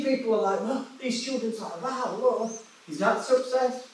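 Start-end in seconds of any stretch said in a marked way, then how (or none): none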